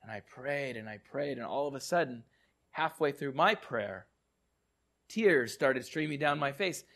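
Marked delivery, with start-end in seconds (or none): none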